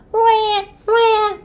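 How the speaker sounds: high-pitched mock wail; baby seal cry. A narrator's reading. Baby seal trying to follow Ebb, crying. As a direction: cry out like a little seal pup. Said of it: "the baby seal cried".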